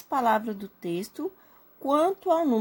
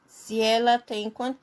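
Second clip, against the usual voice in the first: ordinary delivery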